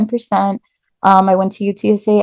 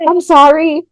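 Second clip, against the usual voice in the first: upset apology; 8 semitones higher, 4 dB louder than usual. Retold or imitated. Teen apologises to shocked mother.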